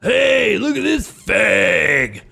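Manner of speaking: high, slow jeering shout; heckler shout. Retold or imitated. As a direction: jeer at him across the crowd. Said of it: "I hear a guy go".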